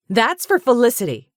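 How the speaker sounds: high, loud, vicious retort; savage. A narrator's reading. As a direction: spit it at him in fury. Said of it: "she said savagely".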